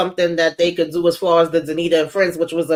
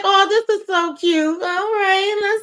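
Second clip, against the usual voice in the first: high-pitched retold gushing; 12 semitones higher than usual. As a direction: coo it sweetly, as if all in the feels. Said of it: "I was all in the feels".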